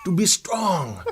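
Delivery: earnest mock Jamaican accent; fake Jamaican accent. Guest claiming good intentions to doubting host, fake Jamaican accent. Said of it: "this is not your real accent".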